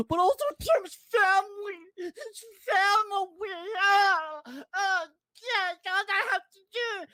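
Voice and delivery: high, mock-tearful whine about family; mocking whiny criminal. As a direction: ham up a fake sob as the criminal. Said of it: "says the criminal".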